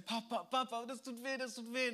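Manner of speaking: put-on high, pleading little boy crying papa; small son crying out during the injection; child in pain. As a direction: voice a hurting toddler.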